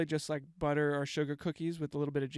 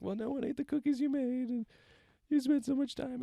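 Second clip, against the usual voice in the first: put-on much higher, a small child's upset voice; sad little kid voice; 10 semitones higher than usual. Telling mom at home why she's sad, in a high little kid voice. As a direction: squeak it out like a hurt eight-year-old. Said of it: "And I was like".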